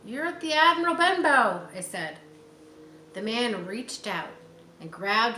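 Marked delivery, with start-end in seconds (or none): 0.0-1.7 put-on boy's voice. A narrator's reading. Jim answers the beggar.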